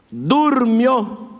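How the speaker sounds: ordinary talk, nothing marked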